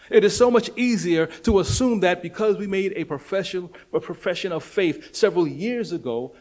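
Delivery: preacher pressing the congregation, full-force preaching; fiery preaching. Acted. Preaching to his church about assumed salvation, full-force preaching.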